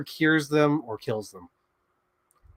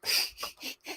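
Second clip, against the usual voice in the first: soft breathy laugh; 12 dB quieter than usual. Real, not acted. Laughs at herself.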